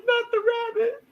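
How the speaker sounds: mock-heartbroken high cry; mock anguish. Viewer grieving Floor's death, high mock wail. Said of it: "I was like".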